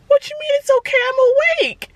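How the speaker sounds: high, crying, panicked plea; terrified cry. Retold or imitated. To the nurse, high terrified crying plea. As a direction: wail it like waking mid-surgery. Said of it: "I was terrified".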